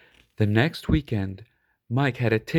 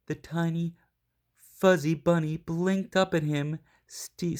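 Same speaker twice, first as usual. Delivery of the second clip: plain reading voice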